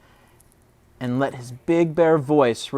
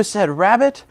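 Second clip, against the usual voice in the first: ordinary delivery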